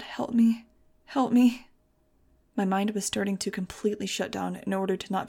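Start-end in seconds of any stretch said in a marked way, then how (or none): 0.0-0.7 breathy whispered prayer, barely voiced; whispered plea. Girl begging God over her dying love, in a whisper. 1.1-1.7 pleading cry, higher and louder; cry for help. Acted. Girl begging God over her dying love, crying out. Desperate prayer over lost Fang.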